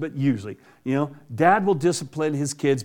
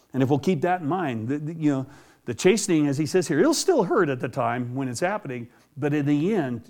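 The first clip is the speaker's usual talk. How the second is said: normal talking voice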